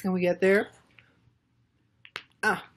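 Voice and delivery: usual speaking voice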